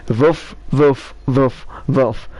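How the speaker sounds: woofs in a foreign accent, W turned to V; borrowed foreign accent. Shown as a demonstration. Telling the dog joke for dogs to understand, foreign accent. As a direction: bark "woof" as "voof", accented. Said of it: "T-, try it with my accent".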